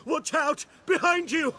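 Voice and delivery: high, panicked cry of warning; a put-on panic shout to distract the witcher; fake alarm. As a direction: sell a fake danger, yelling high. Merchant fakes an alarm to distract.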